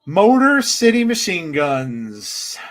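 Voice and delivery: loud, slow, stretched announcer call; ring announcer. Real, not acted. Host announcing the tag team's entrance, big announcer style. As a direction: draw the team name out loud, sliding down at the end.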